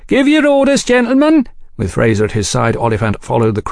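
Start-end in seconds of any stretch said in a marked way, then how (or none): 0.1-1.5 much higher, louder call to the room; waiter shouting. A narrator's reading. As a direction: shout to the whole parlour. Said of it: "a young waiter now shouted".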